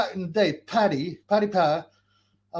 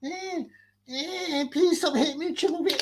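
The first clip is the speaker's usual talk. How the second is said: mock whimper; 12 semitones higher than usual. Mocking the rival begging not to be hit, high whine. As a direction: whine like a beaten coward.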